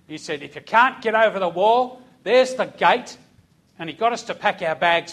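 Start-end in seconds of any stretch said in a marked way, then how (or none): 0.4-3.2 a hint of a drill sergeant, louder; lightly voiced barking sergeant. Sergeant challenges recruits at the wall, a touch louder.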